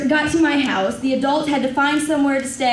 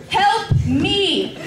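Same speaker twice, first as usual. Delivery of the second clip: higher-pitched, mild cry for help; mock scream for help; 6 semitones higher than usual. Bitten child calling friend for help, mild mock scream. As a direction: act out a half-hearted panicked scream. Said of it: "I'm screaming".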